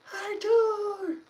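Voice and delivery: a high, piercing cry of his name, as his wife screamed it; retold scream. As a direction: call his name like a scared wife from upstairs. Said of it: "like, screaming".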